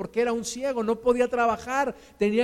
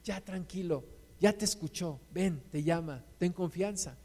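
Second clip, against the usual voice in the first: soft, lowered, reassuring voice; calming; 4 semitones lower, 9 dB quieter than usual. Acted. Someone telling the blind man he was heard, softly calming. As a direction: hush them gently after the shouting.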